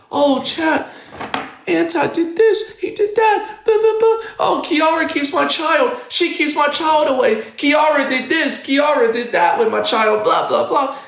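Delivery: high mocking whine of a crying streamer; mocking whiny streamer. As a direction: mimic them complaining to chat. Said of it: "then say".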